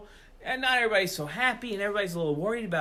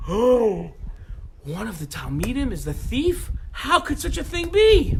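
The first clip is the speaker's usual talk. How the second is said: loud, gasping disbelief; shocked gasp; 4 semitones higher, 7 dB louder than usual. Acted. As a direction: recoil in shock. Students shocked at thief news.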